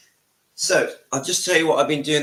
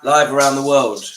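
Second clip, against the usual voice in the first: louder, showman call-out; hyped announcement; 9 dB louder than usual. Real, not acted. Host hyping a live [name] duet with his wife, louder showman call-out. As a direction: announce it to the whole world.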